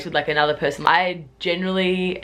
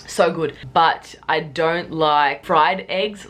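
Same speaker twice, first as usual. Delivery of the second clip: normal talking voice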